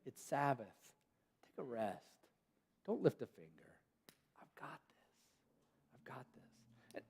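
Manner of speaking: God whispering comfort to stressed people; tender God. Retold or imitated. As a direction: hush and soothe, let them rest. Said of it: "what God is saying".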